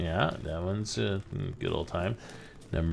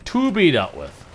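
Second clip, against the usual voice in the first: higher, louder ominous read; dramatic repeat; 8 semitones higher, 8 dB louder than usual. Shown as a demonstration. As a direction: say it like a movie trailer.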